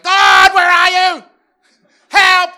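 frantic yelled prayer; desperate shout. Retold or imitated. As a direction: cry out to God at the top of your lungs. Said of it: "sometimes we're like".

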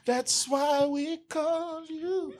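high, drawn out, whiny plea; mock pleading. Believer running back to Jesus, mock whiny plea. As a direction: beg with fake desperation. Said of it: "and then you're".

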